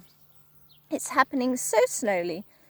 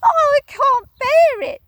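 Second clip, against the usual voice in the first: much higher, louder anguished wail; Missus Twit wailing; 12 dB louder than usual. Acted. Wife hearing her fate, high wail. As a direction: wail it in despair. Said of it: "cried Missus Twit".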